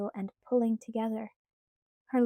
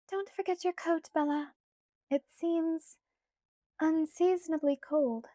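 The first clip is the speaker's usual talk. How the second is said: put-on light, higher girlish pleading voice; Alice's voice; 7 semitones higher than usual. A narrator's reading. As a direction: voice Alice higher, coaxing Bella. Alice anxious.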